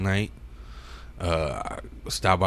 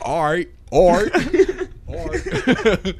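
put-on West Coast accent; 11 semitones higher, 4 dB louder, slower than usual. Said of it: "He's like".

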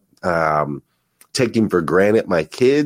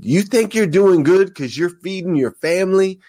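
pumped-up preacher, high-pitched challenge; Eric Thomas impression; 11 semitones higher than usual. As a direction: start the hip hop preacher imitation, voice lifted high. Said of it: "He gets all pumped up".

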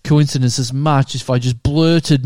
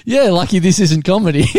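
usual speaking voice

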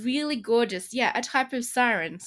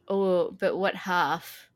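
normal talking voice

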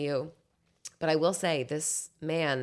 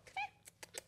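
much higher, cooing pet voice; 14 dB quieter than usual. Real, not acted. Coaxing the roommate's cat over, high cooing baby talk.

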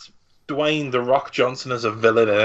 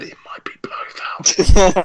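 mock whisper; 5 semitones higher, 4 dB louder, slower than usual. Mocking studio secret.

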